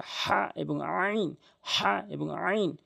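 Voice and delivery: slow "হা" and "আইন" squeezed from mid-throat; mid-throat letters. Shown as a demonstration. Teacher showing two mid-throat letters, slow "হা" and "আইন". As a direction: draw out a tight "হা", then throaty "আইন". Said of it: "হলকের মাঝখান থেকে".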